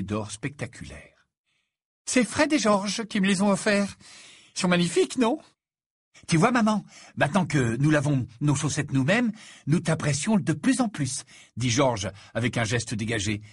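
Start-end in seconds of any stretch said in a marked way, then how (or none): 2.1-11.2 high, lively voice of a joking son; cheeky twins. Acted. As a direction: play a breezy son buttering up his mother. (voix aiguë de fils farceur et désinvolte)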